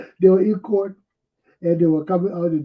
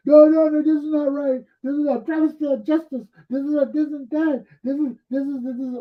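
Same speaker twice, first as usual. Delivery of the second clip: much higher, louder indignant outburst; angry protest; 8 semitones higher, 4 dB louder than usual. Retold or imitated. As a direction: protest like the furious lawyer. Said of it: "Ellen Cummings lawyer pissed off".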